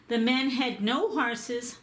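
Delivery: plain reading voice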